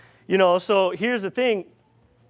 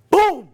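gunshot boom; 9 semitones higher, 7 dB louder than usual. Retold or imitated. Imitating his shotgun going off.

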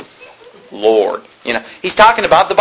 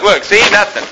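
normal talking voice

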